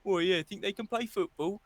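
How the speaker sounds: mock bloke sneering at women's football; mocking fan voice. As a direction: lampoon a sexist football fan, eh. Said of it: "you've been going".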